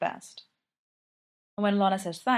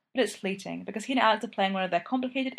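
ordinary delivery, nothing marked

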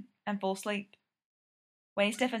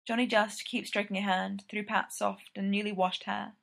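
ordinary delivery, nothing marked

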